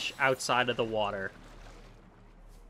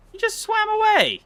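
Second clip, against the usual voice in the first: very high, louder squeal of disbelief; shrieking outrage; 5 dB louder than usual. Real, not acted. Streamer seeing the fish escape again, high shrieking disbelief.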